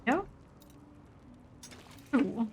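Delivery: ordinary talk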